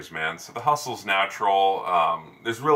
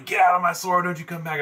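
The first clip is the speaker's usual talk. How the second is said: retold angry shout, pitched up; imitated yelling; 9 semitones higher than usual. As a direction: imitate a furious shopkeeper banishing a customer. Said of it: "He was yelling".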